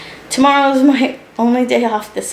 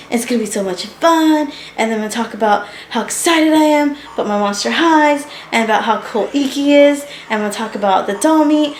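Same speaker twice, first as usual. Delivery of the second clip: imitating an earlier excited self, higher; pumped, full of energy; 4 semitones higher than usual. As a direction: gush through a list of plans. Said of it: "I was all pumped and full of energy".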